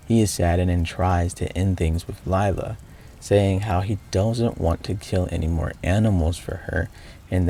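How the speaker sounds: steady recap narration voice; story narration. Acted. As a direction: narrate the game's ending evenly.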